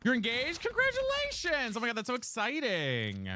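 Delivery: a real delighted shriek, stretched long; excited congratulations. Real, not acted. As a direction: gush over the happy news. Streamer reacts to chat news.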